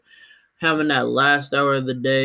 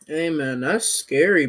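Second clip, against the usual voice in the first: ordinary talk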